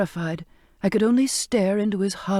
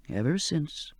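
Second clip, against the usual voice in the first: put-on low, soft old man coaxing; old sailor's voice; 3 semitones lower, 6 dB quieter than usual. Old cook reassuring frightened Charlotte, low and soft. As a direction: coax her gently to touch him.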